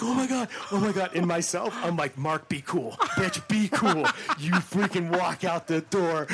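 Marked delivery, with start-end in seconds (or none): 0.0-1.1 imitating a director's stunned, high, loud gasp; freaking out. Director freaking out after the shot goes in, high excited disbelief. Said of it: "He starts freaking out". 2.2-6.3 laughing self-talk. Retold or imitated. Telling himself to stay calm.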